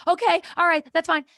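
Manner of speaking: high, loud, overdone agreement; mock backing-off. Daughter told to calm down, high defensive acquiescence. Retold reply to parent saying calm down.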